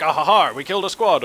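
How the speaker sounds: loud, hammed-up mock British soldier cheering; a gleeful, badly faked British accent. Caster mimics British mortar crew's kill line, bad British accent. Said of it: "I can't do a good British accent".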